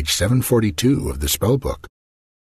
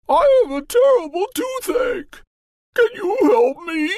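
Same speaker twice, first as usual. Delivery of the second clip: very high, put-on queen's plea; pained queen. Queen begging the magician for help with toothache. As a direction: plead for help through the toothache.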